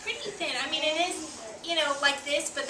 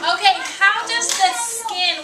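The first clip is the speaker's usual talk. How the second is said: louder, slower call to class; raised teacher voice; 6 semitones higher, 10 dB louder, slower than usual. Acted. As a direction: lift the voice over the class.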